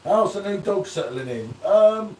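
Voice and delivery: ordinary talk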